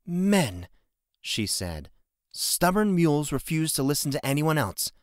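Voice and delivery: fed up with stubborn men; exasperated. A narrator's reading. Innkeeper scoffing at Jensen's promise, mildly irritated. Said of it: "her irritation was clear".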